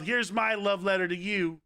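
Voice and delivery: ordinary talk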